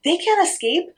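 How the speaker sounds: high, urgent little parrot voice; anxious, high-pitched bird; worried parrot. A narrator's reading. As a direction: plead high for your friends. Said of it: "said the parrot".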